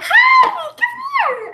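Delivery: put-on very high, loud baby-talk call; excited greeting to baby. Woman greeting a toddler who wandered into class, excited squeal. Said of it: "I was like".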